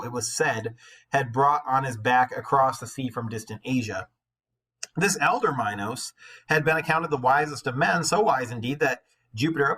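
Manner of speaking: lightly lifted read-aloud voice; steady storybook reading; story narration. A narrator's reading. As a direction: read the myth to the class. Reads the myth aloud.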